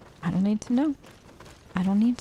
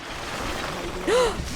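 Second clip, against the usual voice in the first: sharp gasp; 11 semitones higher than usual. Real, not acted. Sudden gasp at enemy.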